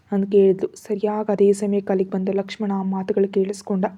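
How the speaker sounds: ordinary delivery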